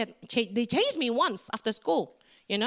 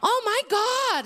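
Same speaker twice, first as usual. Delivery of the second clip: much higher, louder and slow exclamation of wonder; preacher marvelling at God's plan; awed exclamation; 10 semitones higher, 4 dB louder, slower than usual. Real, not acted. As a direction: cry it out in amazement.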